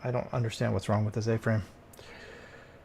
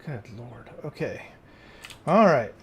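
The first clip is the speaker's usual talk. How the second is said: exasperated; 6 dB louder than usual. Real, not acted. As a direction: bark it out, fed up. Frustrated fixing the house.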